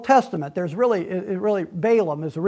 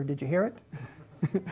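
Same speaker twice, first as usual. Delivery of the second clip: very soft, low, slow question; drawn-out hushed question, mimicking a preacher; retold whisper; 5 semitones lower, 11 dB quieter, much slower than usual. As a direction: imitate the preacher stopping to whisper. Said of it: "He'd just stop and whisper".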